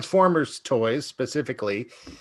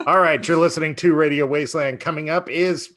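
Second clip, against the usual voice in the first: upbeat radio host sign-off; 5 semitones higher, 4 dB louder than usual. Acted. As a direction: announce it like a late-night DJ.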